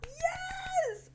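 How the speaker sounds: very high, soft squeal of joy; delighted squeal. Real, not acted. Fan greeting lovely little Aya's arrival, delighted squeal. Thrilled that Aya appears.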